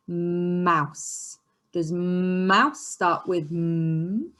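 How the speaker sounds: long hummed m at the start of "mouse"; drawn-out "mmmouse" for phonics; held first sound. Shown as a demonstration. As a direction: stretch the m in "mouse", then say "m" as a hum. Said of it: "it starts with our mm".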